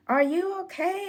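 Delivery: high, anxious calling up; a dad calling up a tree; worried dad. Retold or imitated. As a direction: check on her from below.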